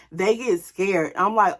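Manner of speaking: usual speaking voice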